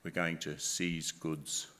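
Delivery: deep, slow voice of menace; threatening caller. Acted. Scam caller threatening to take goods, deep voice. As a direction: drag it out low, like an intimidating official. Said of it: "threatening language which people use".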